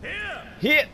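high calls to a teammate; calling out. Real, not acted. Streamer calling his summon to come over, high calls. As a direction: call him over, high and sharp.

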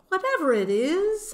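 high, loud, hasty-sounding protest; loud high-voiced excuse; talking her way out. A narrator's reading. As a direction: pitch it way up, bluffing out of trouble. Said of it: "trying to talk my way out of it".

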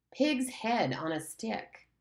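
slow, dragged out words; laboured words. Acted. Simon struggles to speak, slow and laboured. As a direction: force each word out slowly.

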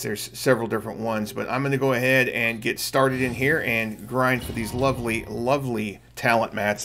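ordinary talk

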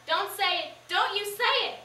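loud, frantic plea; desperate. Acted. Mama to Pete, desperate and loud, cutting him off. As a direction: stop him before he says it. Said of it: "She seemed almost desperate".

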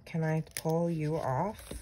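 normal talking voice

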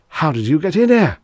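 high, loud burst of disbelief; aghast, voice leaping up; startled gasp. A narrator's reading. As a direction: blurt it out in shocked alarm. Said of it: "he gasped".

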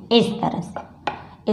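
ordinary delivery

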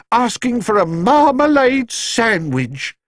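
high, slow news of marmalade sandwich; flustered housekeeper. A narrator's reading. Mrs Bird reports news.

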